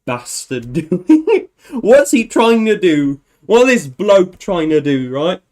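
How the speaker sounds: hammed-up British accent, rising in pitch; mock English accent. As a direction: do an exasperated English bloke complaining. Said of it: "actual English people be like".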